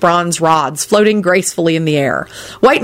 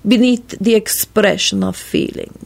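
slow, measured, higher-pitched voice; deliberate; 4 semitones higher than usual. A narrator's reading. Radu finishing his question to the guest, deliberately. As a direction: space the words out deliberately. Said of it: "in his deliberate manner".

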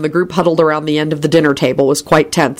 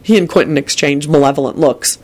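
plain reading voice

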